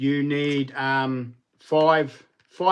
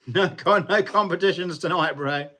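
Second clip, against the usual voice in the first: laughing; 5 semitones higher than usual. Real, not acted. Laughing at chat comment.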